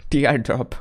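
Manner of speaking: sudden loud breathy laugh; burst of laughter. Real, not acted. Laughing at a tearful laughing emoji.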